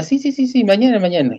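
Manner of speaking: mamá que da largas, voz más aguda; madre evasiva imitada. Retold or imitated. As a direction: imitando a la mamá que lo deja para mañana. Mamá posterga el seguro. (mamá imitada, evasiva y aguda)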